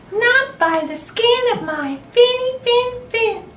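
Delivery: high, squeaky little fish, loud; tiny fish voice. Acted. Little fish refusing the shark, high and squeaky. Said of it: "The little fish trembled and replied".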